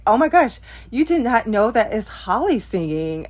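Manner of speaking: retelling her shock at her sister; incredulous exclamation. Retold or imitated. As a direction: gasp at how anyone could miss it. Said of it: "I was like".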